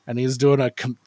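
normal talking voice

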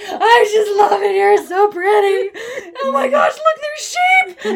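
high, crying and laughing at once; tearful gushing. Retold or imitated. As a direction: gush through happy tears. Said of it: "I started crying".

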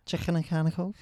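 put-on Scouse practice phrase; Scouse accent. Shown as a demonstration. Repeating Jimmy Carr's trick phrase for a co-host, Scouse accent. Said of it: "There you go".

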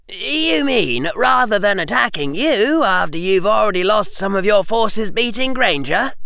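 high, innocent schoolboy; Harry voice. Acted. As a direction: play the innocent.

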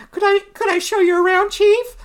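high, loud, eager hostess; Debbie the socialite. Acted. Hostess fawning over her rude guest, high and eager. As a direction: offer a tour in a very high, quick voice. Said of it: "so Debbie's like".

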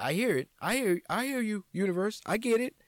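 high, loud mock exasperation; exasperated to universe. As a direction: shout your surrender at the universe. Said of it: "talking to the universe".

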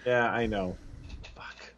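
hushed, near-whispered admission; sheepish whisper, dropping almost to nothing; embarrassed muttering. Real, not acted. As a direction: curse softly under your breath. Embarrassed at failing to recall guest.

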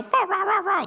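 very high squawking bird call; killdeer cry. Retold or imitated. Mimicking a killdeer luring you from its nest, high squawks. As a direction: squawk like a fake-injured bird. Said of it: "run around like I'm lame ... and make".

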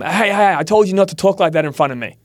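sharp "hey, hey" pitched high; a hammed-up telling-off; mock scolding. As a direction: scold the friend in a mock snap. Scolding friend, voiced example.

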